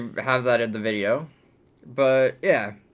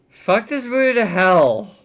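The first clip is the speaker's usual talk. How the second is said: exasperated curse; 8 semitones higher, 6 dB louder than usual. Real, not acted. Cursing the movie.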